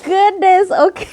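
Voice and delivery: high, drawn-out amazed exclamation; thrilled. Real, not acted. Beauty vlogger seeing all her products, thrilled. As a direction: stretch the opening word, loud and overwhelmed.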